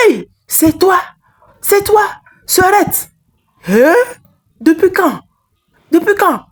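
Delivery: high, stunned exclamations of recognition; shocked recognition. Acted. Woman suddenly recognizing her sister, high astonished cries. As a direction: cry out in disbelief at the sight.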